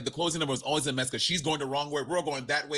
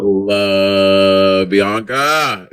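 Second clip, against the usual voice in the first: loud, hugely stretched LOVE; big drawn-out declaration of love; gushing shout; 4 semitones lower, 12 dB louder, much slower than usual. Real, not acted. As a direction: belt it out, holding the word love. Gushing over Bianca.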